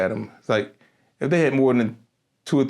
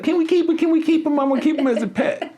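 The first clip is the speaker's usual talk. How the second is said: high-pitched mock begging, laughing at the end; excited pleading lady; faster than usual. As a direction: imitate someone begging in a squeal to keep a pet. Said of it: "of course the lady like".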